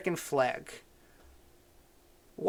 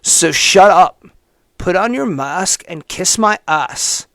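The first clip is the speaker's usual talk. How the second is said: mock hater's voice; 8 dB louder, slower than usual. Host reading a hater's post, slow and loud in mocking voice. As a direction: bark it like the smug writer. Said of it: "Trying to do this in his voice".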